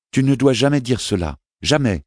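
anguished mother crying out jamais; mother's cry. Acted. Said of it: "s'écria-t-elle".

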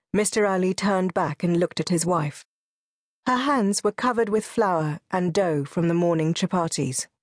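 ordinary delivery, nothing marked

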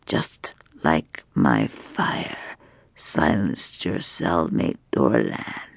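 slow, hushed, menacing whisper of a dragon queen; taunting dragon woman. Acted. Dragon woman taunting her chained prisoner, slow hushed menace. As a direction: let the threat creep out in a breathy hush.

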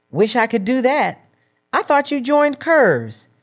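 a hint of envy; wistful sigh. A narrator's reading. Sister admiring the weight loss, mildly wistful. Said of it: "Serena sighed".

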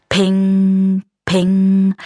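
high ringing ping sound; ping noise. Acted. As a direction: chime it like a metal ping. Same noise again. (helles Ping-Geräusch)

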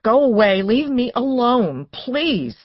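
loud, high plea, slowing to the end; distressed refusal. A narrator's reading. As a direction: push them away, ending in pleading.

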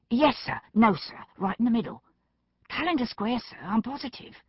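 eager, agitated subordinate; flustered insistence. A narrator's reading. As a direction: insist anxiously to a doubting superior. Flustered constable insists to his doubting superior.

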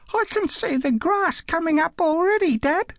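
put-on very high, excited little kid's voice; excited child. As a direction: pipe it up high, thrilled to tell Dad. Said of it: "He didn't miss the excitement in the voice".

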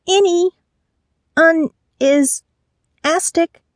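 high, broken muffled cries; muffled words. A narrator's reading. Woman shut in the trunk, muffled high calls. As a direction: strain out word fragments as if gagged. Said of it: "along with a few muffled words".

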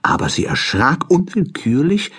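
ordinary delivery, nothing marked

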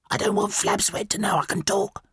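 high, secretive bird voice; hissing caterbird. Acted. Caterbird hiding its speech from Flabsweat, high hiss. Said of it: "the caterbird hissed".

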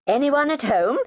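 high, bright and loud, blithe chirp; chirpy greeting. Acted. Neighbour barging in on the sisters, chirping blithely. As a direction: sing out the hello cheerfully. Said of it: "she chirped blithely".

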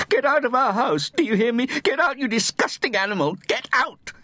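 very high, furious screamed orders; screaming. A narrator's reading. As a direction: scream at the animal to get out. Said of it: "she screamed".

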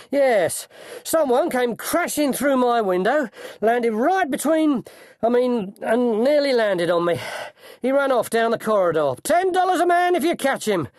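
high, loud flustered outburst; out of breath. A narrator's reading. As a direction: report an intruder breathlessly, quickening toward the end. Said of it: "he's out of breath".

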